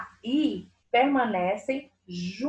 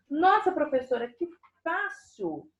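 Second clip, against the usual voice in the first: higher-pitched imitation of an amazed pupil; aluno impressionado; 6 semitones higher than usual. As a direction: play a pupil marvelling, stretching the last words. (imitando um aluno admirado, mais agudo)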